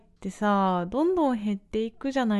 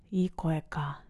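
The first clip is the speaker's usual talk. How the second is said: whispered aside; 5 semitones lower, 6 dB quieter, slower than usual. Real, not acted. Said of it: "muttering to herself".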